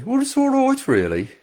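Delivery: high-pitched, downbeat Brummie voice; Birmingham accent. Retold or imitated. Birmingham local answering a radio street interview, downbeat Brummie accent. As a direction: imitate a negative-sounding Brummie interviewee. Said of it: "it's quite a negative sounding accent".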